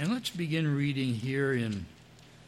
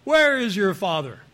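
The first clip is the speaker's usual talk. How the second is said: loud, raised-pitch heckle from the crowd; mock yell, punching the first word; 3 semitones higher, 7 dB louder than usual. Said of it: "somebody yelled out from the crowd".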